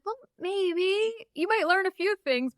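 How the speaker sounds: high, playful mock-coy answer; teasing the readers with a sly maybe; coy tease. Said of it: "I'm like".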